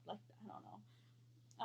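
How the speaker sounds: slow whispered trail-off. Real, not acted.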